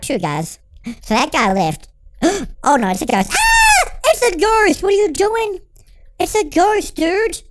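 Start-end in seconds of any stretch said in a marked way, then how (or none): 3.3-7.4 a high, loud scream into frantic cries; high panicked shouting. Real, not acted. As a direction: yell in a panic at the ghost. Ghost appears in game.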